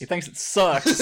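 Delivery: laughing, loud and slow. Real, not acted. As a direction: say it while cracking up. Teasing through laughter.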